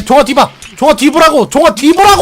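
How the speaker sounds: urgent shout, high and loud; yelling warning. Real, not acted. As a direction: scream at him to look behind.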